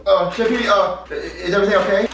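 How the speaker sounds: put-on high, loud, stammering kid voice; Junior, nervous. Shown as a demonstration. Junior distracts the chef, nervous stammer. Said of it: "And I'm gonna go".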